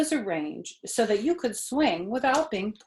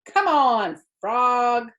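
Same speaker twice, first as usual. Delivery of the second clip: higher, louder call; cried out; 5 semitones higher, 8 dB louder, much slower than usual. A narrator's reading. Avery calling to his frog, louder and higher. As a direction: urge the frog along. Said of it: "cried Avery".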